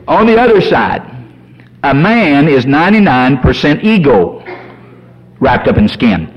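ordinary talk, nothing marked

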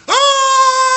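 very high, loud long shriek; hammed-up scream of horror; horrified scream. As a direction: shriek at a gory sight. Supervisor screams seeing no toe.

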